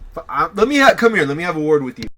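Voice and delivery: sputter, then order him over; angry summons. Acted. Boss calls producer over angrily.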